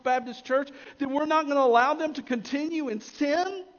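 high, loud, urgent sermon pleading; impassioned preaching. Real, not acted. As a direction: plead with the congregation at full force, pitch held high. Preaching at full force.

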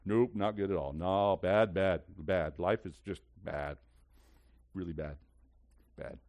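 mock-gloomy, fading out; glum admission. Acts out someone admitting life is bad.

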